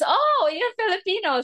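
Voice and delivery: high-pitched imitated pupil's "oh"; surprised realisation. Voicing pupil's delighted realisation. (natingala nga bata, taas ug tingog)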